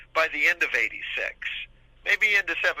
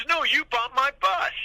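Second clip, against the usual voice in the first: much higher, slow and insistent; the buyer pushing back with attitude; 11 semitones higher than usual. Retold or imitated. The phone caller correcting him, slow, high and pushy. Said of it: "The guy had attitude".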